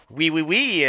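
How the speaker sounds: lightly hammed-up Hollywood French, "oui, oui, oui"; mock movie French accent. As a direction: tease the cliché French of the movies. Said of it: "when you see people in movies, uh, talking".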